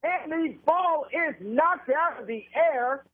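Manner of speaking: radio football call, pitched above casual chat; live play-by-play. Real, not acted. Announcer calls a defensive play and punt to listeners, live play-by-play. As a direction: call the game live, stretching the key words.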